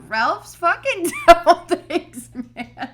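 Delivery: slow laughing rant; cracking up while ranting. Real, not acted.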